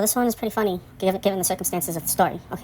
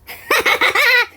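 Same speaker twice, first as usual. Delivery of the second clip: high burst of laughter; 6 dB louder than usual. Real, not acted. Laughing at "nice letter" memory.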